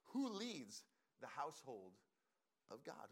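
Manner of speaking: spaced out with pauses before "of God"; measured rhetorical question; slow, deliberate question. Real, not acted. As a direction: pose it slowly, phrase by phrase. Poses key question, then repeats it.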